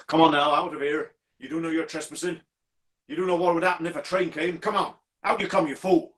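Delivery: high, scolding call into a tunnel. Acted. Signalman calls into the tunnel after the figure, scolding. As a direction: order a trespasser out, rising in urgency. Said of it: "calling out".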